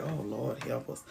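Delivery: low, soft exasperated sigh of a plea; weary mock prayer. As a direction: mutter it like a tired husband. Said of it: "And he like".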